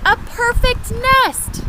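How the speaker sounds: very high, loud and slow with delight; excited cry. Acted. Goose admiring the nest, slow high thrilled cry. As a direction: stretch it out in awe. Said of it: "she cried".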